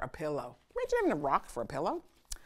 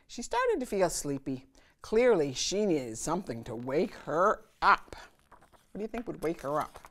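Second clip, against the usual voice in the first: ordinary delivery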